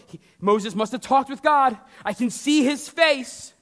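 high, loud voice of astonished onlookers; amazed exclamation. Retold or imitated. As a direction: play the crowd crying out in wonder. The world exclaims about Moses.

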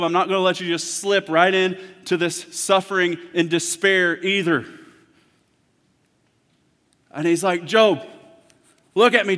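loud, high pleading preacher voice; impassioned preaching. Acted. Preacher voicing God calling Job, loud and high. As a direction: call Job back to God. Preaching God's comfort loudly.

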